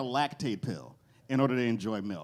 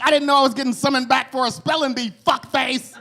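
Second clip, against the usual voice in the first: higher, louder, fast irritated spirit; annoyed spirit; 12 semitones higher, 10 dB louder than usual. Acted. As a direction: snap back like an insulted ghost. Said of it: "And the Spirit's like".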